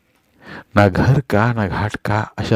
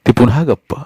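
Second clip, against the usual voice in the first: usual speaking voice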